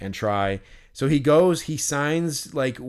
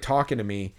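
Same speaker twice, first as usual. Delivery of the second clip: ordinary talk, nothing marked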